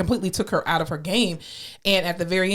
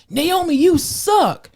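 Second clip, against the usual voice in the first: much higher, slow, mock heckler yell; retold heckle; 10 semitones higher, 4 dB louder, much slower than usual. Heckler in the crowd screaming at Naomi, mock yell. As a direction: jeer from the stands like a heckler. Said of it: "screamed out".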